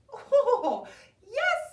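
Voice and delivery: a giggly, high-pitched princess. Acted. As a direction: chirp it out gleefully like a young princess. Princess answers brightly.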